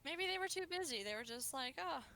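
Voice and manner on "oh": ordinary delivery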